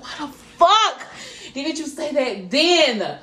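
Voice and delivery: loud, high, drawn-out exasperation; retold outburst. Retelling her reaction to his excuse, exasperated shout. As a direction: slowly belt out each word in disbelief.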